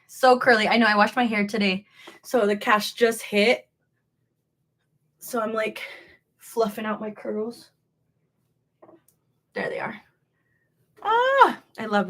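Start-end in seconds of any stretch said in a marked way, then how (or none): none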